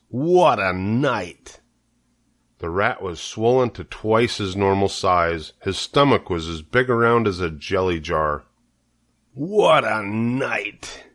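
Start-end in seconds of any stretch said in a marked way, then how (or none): 0.1-1.6 drawn-out, hoarse rat drawl; slow husky rat. Acted. Rat groaning to Charlotte about his feast, slow and hoarse. 9.3-11.0 slow, drawn-out hoarse repeat; hoarse rat. Acted. Rat repeating his boast to Wilbur, slow and hoarse. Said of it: "he repeated hoarsely".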